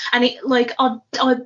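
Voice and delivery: normal talking voice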